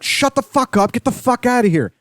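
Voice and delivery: high, indignant mock yelling; pissed-off dismissal. Interrupted couple chasing off the passerby, pissed off. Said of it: "they were quite pissed off at me".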